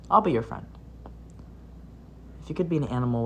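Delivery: ordinary talk, nothing marked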